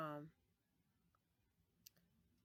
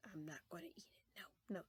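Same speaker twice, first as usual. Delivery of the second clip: hushed refusal; 9 dB quieter than usual. Retold or imitated. Refusing the chip.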